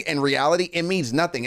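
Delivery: ordinary delivery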